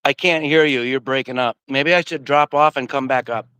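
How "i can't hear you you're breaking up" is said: ordinary delivery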